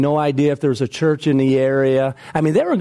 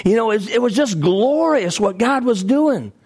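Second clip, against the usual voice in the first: ordinary delivery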